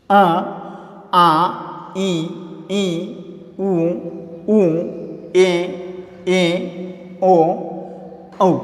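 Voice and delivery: vowels recited one by one, teacher style; reciting vowels. Shown as a demonstration. Teacher drills Hindi vowels for students, clearly. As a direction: sound out each vowel for the class. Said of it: "अब देखिए".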